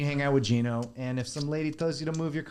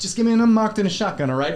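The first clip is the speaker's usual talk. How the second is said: louder, higher pushy demand; mock tough cop; 5 semitones higher, 8 dB louder than usual. Retelling a cop movie scene, mock tough cop. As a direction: play the cocky detective. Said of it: "He's like".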